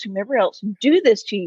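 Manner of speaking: usual speaking voice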